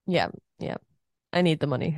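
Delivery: normal talking voice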